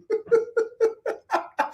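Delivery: high-pitched breathy laughing burst; high laughter. Real, not acted. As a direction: let a squeaky laugh burst out.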